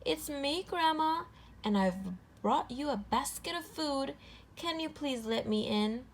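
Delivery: high, sugary fake-sweet voice; a wolf faking a sweet child; sweet-talking his way through the door; sweetest voice. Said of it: "said in the sweetest voice he could muster".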